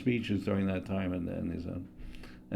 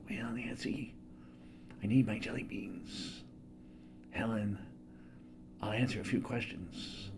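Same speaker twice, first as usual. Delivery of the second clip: put-on much softer, hushed elderly president; breathy hush, starting in a whisper; Ronald Reagan impression; 12 dB quieter than usual. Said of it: "he had a very low voice".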